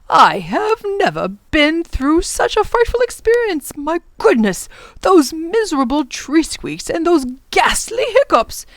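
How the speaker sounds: high, swooping, theatrical lament; appalled professor. Acted. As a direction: lament the ordeal with great emphasis. Professor laments the frightful experience.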